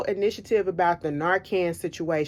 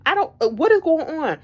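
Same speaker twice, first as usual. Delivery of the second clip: higher, louder exasperated outburst; 9 semitones higher, 3 dB louder than usual. Retold or imitated. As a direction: throw your hands up, you can't take it. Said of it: "I said".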